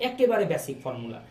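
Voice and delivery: ordinary talk, nothing marked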